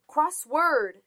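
loud, high repeated shout; shouted again. A narrator's reading. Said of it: "shouted Mouse again".

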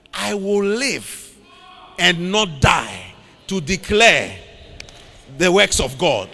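slow, deliberate scripture quote; weighty, unhurried recitation at normal volume; measured declaration. Acted. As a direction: declare it slowly, phrase by phrase. Quotes David's declaration, first take.

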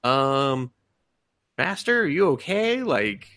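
high, puzzled servant voice; worried servant. Retold or imitated. Servant asks the confused demon queen what's wrong, high and puzzled. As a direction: timidly check on the master. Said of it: "is like".

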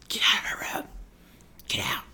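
urgent whispered get out. Acted. Viewer whispering at a character on screen, urgent whisper. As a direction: whisper it like a warning to the intruder.

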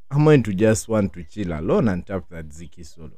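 usual speaking voice

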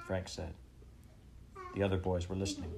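plain reading voice

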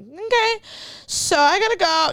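high, strained imitation of holding back tears; retold cracking voice. Retelling a rejection on the phone, mock tearful. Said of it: "my voice cracked, like, I was crying".

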